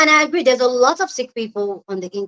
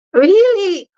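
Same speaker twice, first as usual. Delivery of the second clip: surprised; 10 semitones higher, 5 dB louder than usual. Real, not acted. Surprised as a viewer joins.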